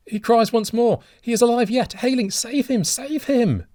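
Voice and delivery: high, loud begging; desperate plea. A narrator's reading. Father begging the stranger to save his drowning son, frantic. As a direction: beg with everything you have. Father begs for son's life.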